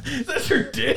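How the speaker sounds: high squeal through laughter; laughing high. Real, not acted. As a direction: laugh it out in shock. Laughing shocked reaction.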